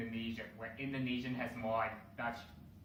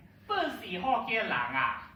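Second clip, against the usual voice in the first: high, loud, put-on Malaysian Chinese voice; Hokkien-accented Mandarin; 8 semitones higher, 7 dB louder than usual. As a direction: bark it like an auntie from Penang. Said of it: "which literally translates to".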